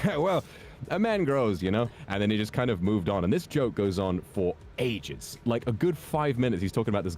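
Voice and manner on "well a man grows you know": higher comic storyteller voice; Norm Macdonald. Retold or imitated. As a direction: shrug it off in a put-on comedian's voice. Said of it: "And he goes".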